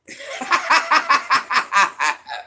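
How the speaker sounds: high, loud rattling laugh, slowly falling; big cackling laugh. Real, not acted. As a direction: burst out in a long staccato cackle. Laughs after wrinkle machine joke.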